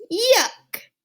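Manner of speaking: high, disgusted yelp. Acted. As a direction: squeal yuck in disgust. Hamster disgusted by kiss.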